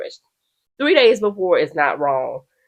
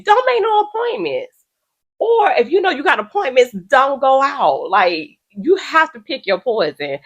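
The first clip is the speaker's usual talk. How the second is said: higher, slow, emphatic scolding; scolding lecture; 8 semitones higher than usual. Real, not acted. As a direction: lay down the rule like a scold. Lecturing unprofessional stylists.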